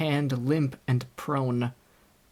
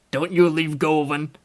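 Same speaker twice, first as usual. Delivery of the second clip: a touch of a rough country labourer, calling out louder; rustic navvy; 5 dB louder than usual. A narrator's reading. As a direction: call out like a rural workman. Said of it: "cried the big navvy".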